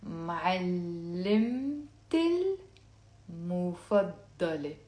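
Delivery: drawn-out syllables, pausing between words; very slowly. Shown as a demonstration. Teacher asking learners to say it along, very slowly. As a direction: stretch معلمت المفضلة right out. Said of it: "I'll say it very slowly".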